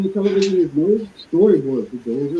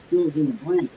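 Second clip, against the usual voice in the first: ordinary talk, nothing marked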